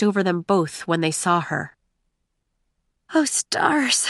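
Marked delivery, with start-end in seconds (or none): 3.1-4.1 startled whisper. A narrator's reading. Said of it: "whispered Maiko".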